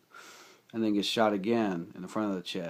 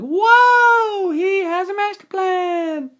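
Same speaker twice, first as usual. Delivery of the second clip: very high, loud, slow astonished cry; amazed; 13 dB louder, much slower than usual. Retold or imitated. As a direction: gasp out the big reveal. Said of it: "and I'm like".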